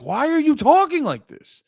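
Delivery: imitating someone fed up with the shouting; mock exasperated retort. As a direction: throw it back at them in a high mock voice. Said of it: "And you're like".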